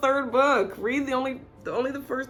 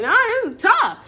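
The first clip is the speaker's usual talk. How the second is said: mock hyped praise; 14 semitones higher, 9 dB louder than usual. Guy praising her art.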